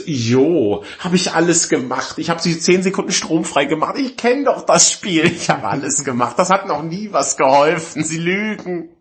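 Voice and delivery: high, indignant mock rant; hammed-up fed-up customer; exasperated accusation. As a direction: argue back with rising pitch. Acted argument with hotline, accusing.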